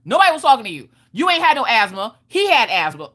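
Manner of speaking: high, snippy comic bickering; mock-indignant contradiction, starting high; retold fussing. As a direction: act out a parent fussing back. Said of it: "fussing back and forth".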